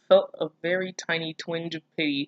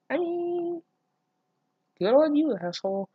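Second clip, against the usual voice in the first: sarcastic praise; 10 semitones higher than usual. Real, not acted. Reader mocking the boy's choice, high sarcastic praise. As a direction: praise him with biting sarcasm.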